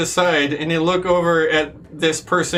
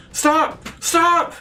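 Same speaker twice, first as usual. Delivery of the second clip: yelling stop; 13 semitones higher than usual. Acted. Phoenix shouting at the elves to hold their fire, high desperate yell. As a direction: yell it out to halt the archers. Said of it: "Phoenix yells out".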